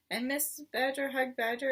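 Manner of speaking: plain reading voice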